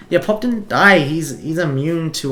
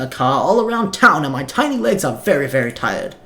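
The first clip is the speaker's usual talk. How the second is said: higher, louder excited little dog professor; a tired tiny dog calling class to order, pitched up; game streamer voicing a tiny dog professor addressing students, raised high and loud; 4 semitones higher, 4 dB louder than usual.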